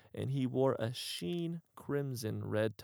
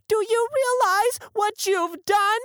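much higher, much louder shriek of rage; furious shout; 10 dB louder than usual. Acted. Drew holds Bell up and screams in fury. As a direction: scream it right into his face. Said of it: "shouting in his face spat".

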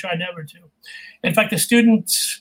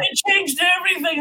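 high-pitched dismayed outburst; exasperated exclamation; 10 semitones higher than usual. Real, not acted. Coach recalling the Invisalign change, high exclamation. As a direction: cry it out high, half amused. Exclaims over a ruined course.

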